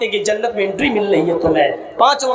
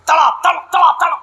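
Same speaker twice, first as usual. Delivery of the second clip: loud mock thwacks of a broom, tada tada; shouted broom-beating noises; mock beating sounds; 6 semitones higher, 4 dB louder, faster than usual. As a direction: bang out the broom blows. Wife beating pir with broom.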